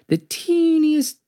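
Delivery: very high, slow squeaky voice; tiny squeak. A narrator's reading. Stresses spider's smallness.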